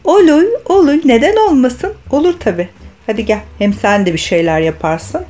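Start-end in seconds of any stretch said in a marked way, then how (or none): 0.0-2.7 high, eager, louder reply. Real, not acted. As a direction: answer with cheerful enthusiasm. Mother answers doll eagerly.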